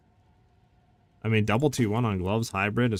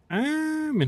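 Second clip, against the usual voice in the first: high squeak. Real, not acted. Sudden high voice.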